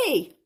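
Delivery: loud, short whinny; mock neigh from a goose. A narrator's reading. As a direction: imitate a horse's neigh. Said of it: "Then the goose said".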